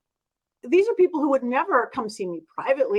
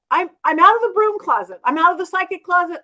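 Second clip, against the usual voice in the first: higher, louder retold declaration; emphatic announcement; 6 semitones higher, 6 dB louder than usual. Realizing the client knows her secret, emphatic announcement. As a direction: proclaim it with rising energy.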